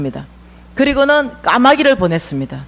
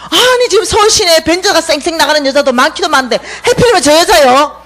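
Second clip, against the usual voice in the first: whining protest; 5 semitones higher than usual. Acted. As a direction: protest like a child about to cry. Elijah protesting God's choice.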